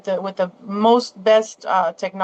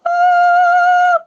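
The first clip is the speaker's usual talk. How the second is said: long, loud, very high held note; sustained dolphin call; high dolphin squeal; 6 dB louder than usual. Shown as a demonstration. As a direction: hold a high E like a violin. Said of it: "so it's like".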